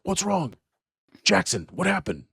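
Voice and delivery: high, urgent guard voices; alarmed guards. Acted. Guards running in ask what's wrong, high and urgent. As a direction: rush in asking what happened. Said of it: "and they're like".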